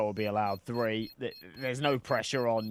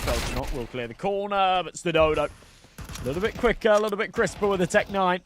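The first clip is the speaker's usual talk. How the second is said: play-by-play commentary; 5 dB louder than usual. Acted. Calm casting of next round.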